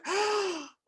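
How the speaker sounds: a sharp, high gasp of mock terror; terrified gasp. Friend hearing the bad deal story, gasping in mock terror. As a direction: gasp like you just heard a horror story. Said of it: "like, terrified".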